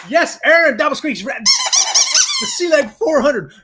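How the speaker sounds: stretched, very high squealing race-caller cry; hammed-up track announcer hollering the win; over-the-top mock race call.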